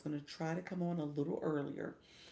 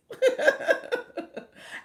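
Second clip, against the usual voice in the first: very high, loud burst of laughter; real loud laugh; 13 dB louder than usual. Real, not acted. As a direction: crack up at yourself. Laughing at own excitement.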